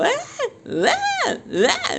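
high yelping goat cries; imitated goat screams. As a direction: mimic screaming goats in short bursts. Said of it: "se la pasan gritando".

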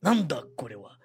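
low, gruff mock Japanese phrase. Imitating Japanese to a friend, gruff mock Japanese. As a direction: bark it out like an angry Japanese speaker. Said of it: "parece que tá brabo".

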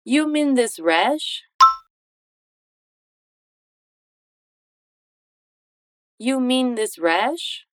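slow, with "rash?" rising at the end; question intonation. Shown as a demonstration. Teacher models question intonation for repetition. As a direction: let "You mean this rash?" rise like a question. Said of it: "com a entonação de pergunta".

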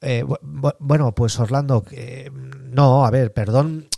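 slow, stumbling, full of fillers; hesitant, halting apology. Shown as a demonstration. Salesman fumbling his apology to Orlando, halting and unsure. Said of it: "No puedes decir".

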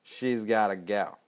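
a light imitation of a southern twang. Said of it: "The whole southern twang is just awesome".

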